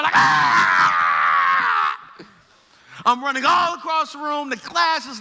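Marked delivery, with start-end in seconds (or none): 0.2-2.0 loud panicked scream; a frightened little-girl yelp. Retold or imitated. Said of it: "I scream like a little girl".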